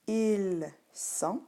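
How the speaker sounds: "Ils sont" slowly as il, son; drawn-out, dictated "Ils sont"; silent S. Shown as a demonstration. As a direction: separate "Ils" and "sont", plural s unheard. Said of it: "même si tu ne l'entends pas".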